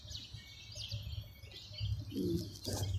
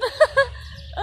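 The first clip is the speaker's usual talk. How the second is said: breathy laugh; 13 semitones higher, 7 dB louder than usual. Real, not acted. Laughing at peacock.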